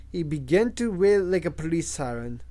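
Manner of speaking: ordinary delivery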